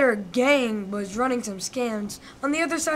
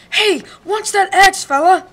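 pained shout; 6 semitones higher, 9 dB louder than usual. Acted. Wolf hit, shouting.

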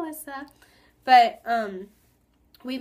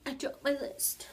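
slow, sucky mock British accent; a bad put-on British accent, drawn out; 3 semitones higher, 5 dB quieter, slower than usual. Vlogger joking in a bad British accent, slow mock British. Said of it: "I just talked in a British accent".

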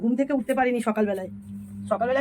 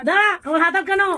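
higher and louder, hurried; loud call; 8 semitones higher, 7 dB louder than usual. Real, not acted. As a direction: call back that your hands are full. Calling out, hands busy. (হাত আটকে থাকায় জোরে হাঁক)

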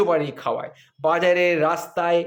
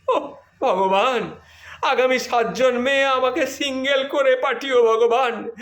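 mock prayer; 6 semitones higher than usual. Husband prays to God for a single life, mock pleading. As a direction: beg heaven in comic despair. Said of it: "comic prayer to god".

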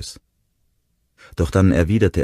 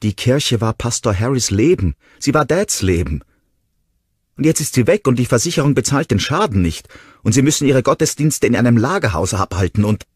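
higher and louder, fast and upset; agitated shout; 9 semitones higher, 7 dB louder than usual. A narrator's reading. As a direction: pour it all out in anger. Ronnie sigue acusando.

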